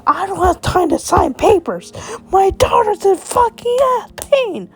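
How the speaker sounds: high, loud furious yell; angry father. Acted. Father yelling at the doctor about his daughter, loud and furious. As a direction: shout down the doctor, frantic.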